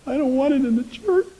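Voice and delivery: retelling a sister's tearful refusal, voice breaking high; a sister crying that she won't go to church; tearful pleading. Retold or imitated. Sister refusing, retold with cry.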